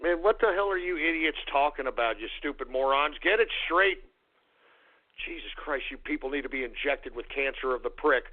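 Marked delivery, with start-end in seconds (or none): none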